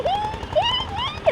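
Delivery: high teasing hee hee; a squeaky mocking giggle. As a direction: titter high like the onlookers. Mocking how people stare.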